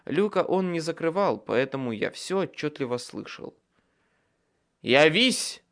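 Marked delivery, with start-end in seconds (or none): 4.8-5.6 loud harsh bark of an order; barked command. A narrator's reading. Wizard forcing a nightmare into the circle, barked order. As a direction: snap the summons at the creature. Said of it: "рявкнул я".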